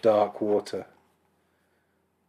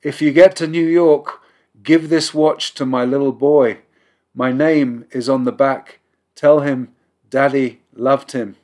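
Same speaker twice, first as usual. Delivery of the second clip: pleading; 4 semitones higher, 7 dB louder than usual. A narrator's reading. Man begging boy's father.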